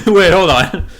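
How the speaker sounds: loud mock double take; mock alarm. Real, not acted. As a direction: stop everything, hold on.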